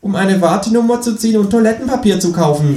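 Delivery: a high, cheeky kangaroo asking. Acted. Kangaroo asks the narrator abseiling from the balcony, high and cheeky. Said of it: "sagt das Känguru".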